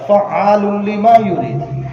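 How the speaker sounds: slow, high, loud Arabic recitation; Quran recitation. Acted. Preacher citing Surah Buruj to listeners, slow ringing recitation. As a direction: proclaim the verse, stretching the syllables.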